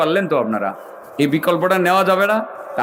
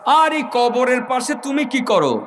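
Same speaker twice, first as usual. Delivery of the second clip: high, calling-out rebuke; acted high-pitched challenge from an unseen voice; commanding dream voice; 5 semitones higher than usual. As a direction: call to a sleeper, then scold him. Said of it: "কে যেন তাকে বলতেছিল".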